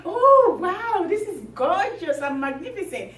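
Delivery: big high "Oh", then gushing; feigned awe. Acted. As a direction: exclaim in show-off admiration. Emperor feigning awe at cloth.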